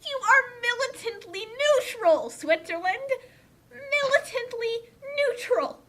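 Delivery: indignant protest. Real, not acted. Outraged protest at the absurd plot.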